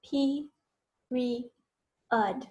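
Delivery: "P, re, ud" as three separate syllables with clear gaps; isolated sounds "P... re... ud". Shown as a demonstration. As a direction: break it into "P", "re", "ud", pausing after each. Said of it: "think about those three syllables".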